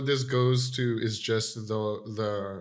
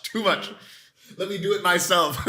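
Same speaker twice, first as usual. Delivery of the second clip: playful Peter; 6 semitones higher, 3 dB louder than usual. Retold or imitated. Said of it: "Peter being like".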